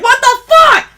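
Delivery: high squealed "what the"; mock outrage. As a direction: act out the shocked reaction. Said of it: "I'm like".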